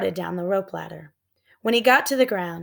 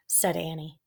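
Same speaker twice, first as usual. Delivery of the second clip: usual speaking voice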